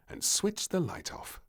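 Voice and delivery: ordinary delivery, nothing marked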